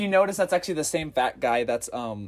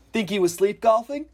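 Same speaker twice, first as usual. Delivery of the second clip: dad joke line; 9 semitones higher, 9 dB louder than usual. Retold or imitated. Dad joke quoted in movie.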